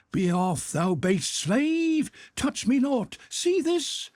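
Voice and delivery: high, theatrical heroic shout; wild shout. A narrator's reading. Drunk old man shouts at the servants, high and theatrical. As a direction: brandish the words like a pistol. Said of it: "shouted Makar Alexeyevich".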